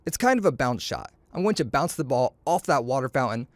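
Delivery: plain reading voice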